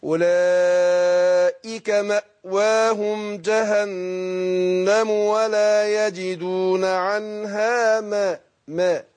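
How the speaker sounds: very slow, deliberate verse reading; slow recitation demo. Shown as a demonstration. Teacher to student modelling the verse very slowly. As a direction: recite each word drawn out for the student. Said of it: "وبعدين أقول إيه؟".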